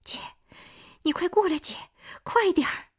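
high, tearful pleading; crying on the phone. A narrator's reading. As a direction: beg her to hurry, crying. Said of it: "夏季哭着给夏红打电话".

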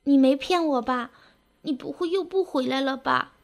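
tearful, choked plea. A narrator's reading. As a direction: ask it with a lump in the throat. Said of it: "花儿哽咽着说".

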